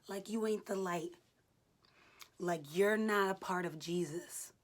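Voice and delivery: preaching. Real, not acted. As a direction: preach it with full force. Fervent preaching during workout.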